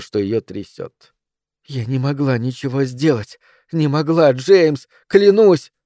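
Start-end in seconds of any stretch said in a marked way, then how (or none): 1.7-5.7 climbing higher and louder to a cry; desperate outburst. A narrator's reading. Guilty mother swearing to husband, desperate. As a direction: let the words burst out, shaking. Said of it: "Слова вырвались у неё".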